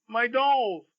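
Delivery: very high, acted wail; Grace's cry. A narrator's reading. Grandfather reading a girl's line from his book, mock crying. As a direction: cry out high like a child, hammed up. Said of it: "And Grace cries".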